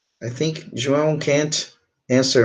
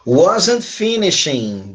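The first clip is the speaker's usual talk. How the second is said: said slowly and drawn out; slow modelling; 2 semitones higher, much slower than usual. Shown as a demonstration. Teacher gives the class the answer, slow modelling. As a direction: model the verb phrase at half speed.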